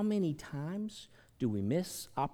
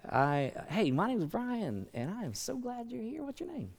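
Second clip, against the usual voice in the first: bright, higher welcoming voice, climbing as it goes; friendly greeter; 7 semitones higher than usual. Pretend. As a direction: play the eager church greeter welcoming a newcomer. Demonstrating greeting a church visitor.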